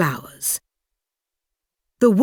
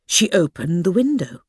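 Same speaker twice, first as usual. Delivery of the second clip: ordinary delivery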